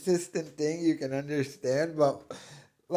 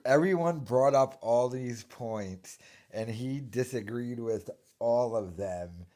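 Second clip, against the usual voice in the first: normal talking voice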